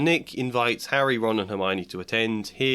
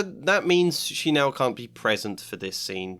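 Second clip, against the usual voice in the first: ordinary delivery, nothing marked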